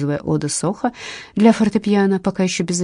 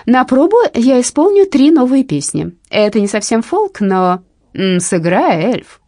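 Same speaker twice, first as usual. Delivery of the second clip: loud; 5 semitones higher, 6 dB louder than usual. Acted. Shouted from audience.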